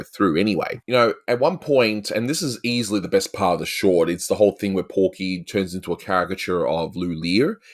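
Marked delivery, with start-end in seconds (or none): none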